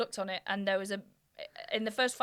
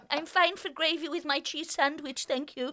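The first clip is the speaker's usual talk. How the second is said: put-on prim little private school kid, higher; prim posh kid; 5 semitones higher than usual. Private school kid turning down gravy from the dinner lady, prim and polite. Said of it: "I was like".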